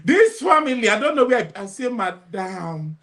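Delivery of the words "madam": slow, soft calming voice; calm soothing question. Retold or imitated. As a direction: gently ask what happened. Coach calmly asks.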